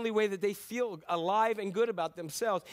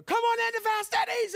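much higher and louder, a bellowed sales call; yelling ad pitch; 6 dB louder than usual. Retold or imitated. Loud advertiser yelling at viewers to come in, hammed-up shout. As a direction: blast it like an old TV pitchman. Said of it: "scream and yell at you".

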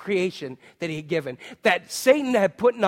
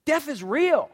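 ordinary delivery

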